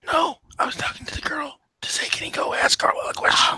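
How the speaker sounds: soft unvoiced whisper. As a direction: keep it secret, whisper it. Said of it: "Why are you whispering?".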